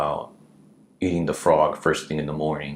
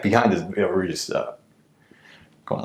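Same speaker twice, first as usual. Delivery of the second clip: ordinary talk, nothing marked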